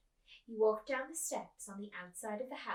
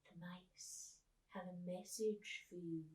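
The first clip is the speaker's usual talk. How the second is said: slow breathless secret whisper; 7 dB quieter, slower than usual. Acted. Old man whispers the mice's secret to Coraline, hushed. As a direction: breathe it into her ear. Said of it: "he whispered".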